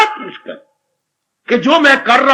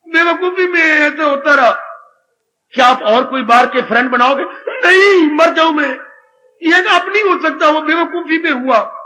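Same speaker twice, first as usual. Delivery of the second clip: acted crying penitent; 5 semitones higher than usual. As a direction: plead through tears, ashamed of it all. (रोते हुए पछतावे की ऊँची आवाज़)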